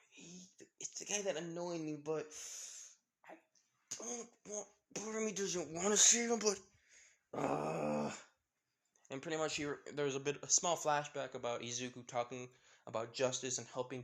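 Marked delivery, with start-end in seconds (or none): 0.1-8.1 high, halting, torn complaint; reluctant grumbling. A narrator's reading. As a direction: whine through the reluctance, haltingly. Character torn about saving annoying boy.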